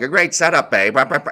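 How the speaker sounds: hammed-up celebrity voice dropping babe at the end; Dennis Miller impression. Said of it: "he used to do Dennis Miller's like".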